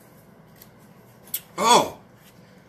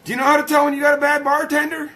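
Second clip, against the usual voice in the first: fast, high-pitched question; quick repeat; 10 semitones higher, 4 dB louder, faster than usual. Real, not acted. Streamer re-asking the joke set-up, fast and high.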